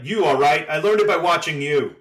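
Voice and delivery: hammed-up eighties anti-drug ad retort; mock kid outburst. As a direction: yell back like a kid accusing dad. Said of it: "And the kid goes".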